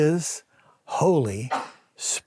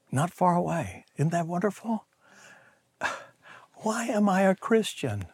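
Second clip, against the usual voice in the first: ordinary talk, nothing marked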